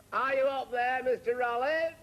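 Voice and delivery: high, loud call up the stairs; calling upstairs as the landlady; brisk nosy landlady. Retold or imitated.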